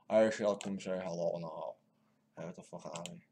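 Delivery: usual speaking voice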